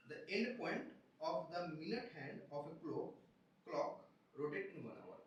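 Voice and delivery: slow, measured question read-out; deliberate, spaced-out textbook reading. Acted. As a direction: read the problem out word by word, slowly. Reads exam question again.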